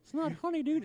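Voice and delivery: high, soft puppet voice; protesting puppet. Acted. Puppet objects to jokes about his misery, protesting puppet voice.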